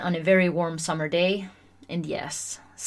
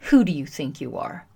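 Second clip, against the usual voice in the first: lower, falling, confrontational question; usual challenging put-down; 4 semitones lower than usual. Shown as a demonstration. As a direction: say it as an accusing challenge, dropping at the end. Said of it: "how we usually think or hear these words".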